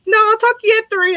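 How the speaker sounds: high-pitched, hurried-off refusal in imitation; mock backing out. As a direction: back out in a squeaky mock voice. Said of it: "And then they'll be like".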